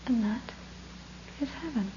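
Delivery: soft, hushed monk with a pause; very gentle, calm. Acted. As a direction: let it land gently and quietly. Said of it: "very gently, very calmly".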